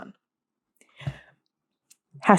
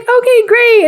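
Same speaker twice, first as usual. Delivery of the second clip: high, louder retold "okay, great"; perky mock reply; 13 semitones higher, 5 dB louder, slower than usual. Woman recapping a date's reply, perky. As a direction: put on an eager, high-pitched agreement.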